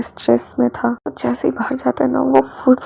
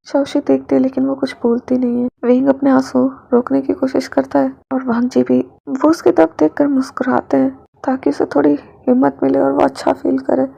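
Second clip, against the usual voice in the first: ordinary delivery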